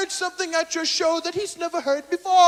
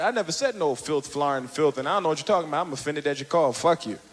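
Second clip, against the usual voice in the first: very low, innocent then rude; deep voice, feigned innocence; deep put-on innocent voice; 13 semitones lower, 6 dB quieter, faster than usual. Denying to Cosby.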